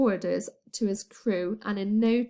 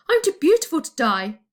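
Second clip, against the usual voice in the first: loud, fast, higher mock wail; hammed-up wail; 6 semitones higher, 7 dB louder, faster than usual. A narrator's reading. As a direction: wail it out in a rush, over the top. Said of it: "Grogba wailed".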